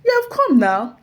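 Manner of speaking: high, loud joyful cheer; imitating cheering colleagues. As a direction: welcome her back with a laughing cheer. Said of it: "And they said".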